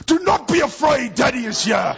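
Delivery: loud, high, barked reassurance; shouted command. Shown as a demonstration. Father demonstrating the wrong way to comfort Eden, shouted command. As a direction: shout it like a drill sergeant's order. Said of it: "a drill sergeant shouting at you".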